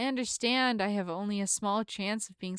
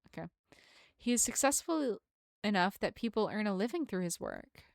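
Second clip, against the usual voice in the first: usual speaking voice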